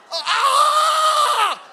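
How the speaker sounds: high shocked yelp; a sudden jolt scream; shrill cry of a man getting shocked; electrocuted scream. Retold or imitated.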